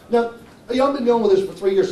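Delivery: ordinary talk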